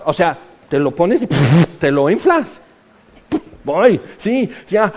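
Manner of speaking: normal talking voice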